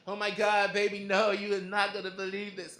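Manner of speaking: loud, excited gushing; loud girlfriend blurting out excitedly. Retold or imitated. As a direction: burst out with the big news. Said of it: "she's like".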